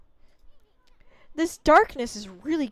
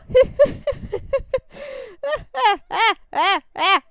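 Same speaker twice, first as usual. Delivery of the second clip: loud giggling fit, higher; high embarrassed laugh; 7 dB louder than usual. Real, not acted. Player laughing at the awkward robe moment. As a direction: burst out laughing at the awkward scene.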